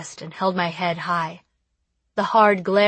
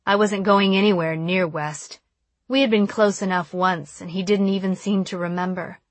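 usual speaking voice

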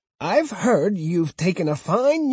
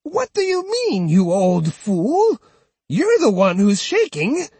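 put-on high, louder scolding old woman; furious; 10 semitones higher, 3 dB louder than usual. Old woman rounding furiously on the uncle, high and loud. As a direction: snap at him in fury. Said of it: "She turned on him furiously".

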